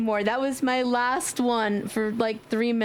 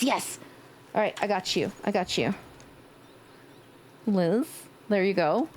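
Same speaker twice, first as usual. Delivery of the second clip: usual speaking voice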